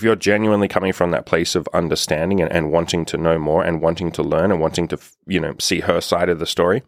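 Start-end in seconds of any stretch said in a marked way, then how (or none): none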